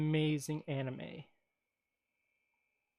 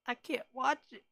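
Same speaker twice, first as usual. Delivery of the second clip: high, whiny I-can't-watch-it voice; half-hearted wail of dismay; mock whine at a sad anime; 8 semitones higher than usual. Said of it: "And I was like".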